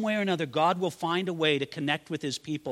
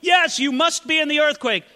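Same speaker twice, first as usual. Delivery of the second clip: higher, louder awed exclamation; excited prophet; 10 semitones higher, 7 dB louder than usual. Acted. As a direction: Elijah crying out at the quake. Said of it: "see Elijah there".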